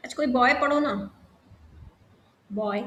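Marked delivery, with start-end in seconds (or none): none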